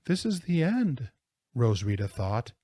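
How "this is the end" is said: despairing. A narrator's reading. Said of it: "she must be crying".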